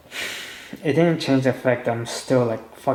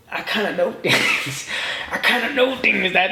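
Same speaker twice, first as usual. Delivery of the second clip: laughing tease; 6 semitones higher than usual. Retold or imitated. Quoting himself to the drunk.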